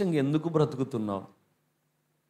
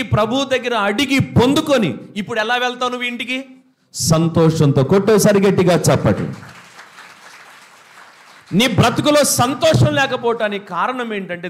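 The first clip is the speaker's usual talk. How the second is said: raised, louder preaching at full force; 9 semitones higher, 6 dB louder than usual. Acted. Pastor urging the church to seek what was lost, preaching loudly. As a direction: drive the exhortation home to the congregation.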